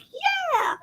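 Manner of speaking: very high, thin squeal of agreement; excited. Retold or imitated. Mom sharing her son's tomato delight, excited. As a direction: squeal along, re-enacting the delight. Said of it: "I was so excited".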